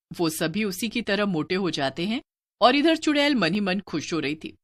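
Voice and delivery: ordinary delivery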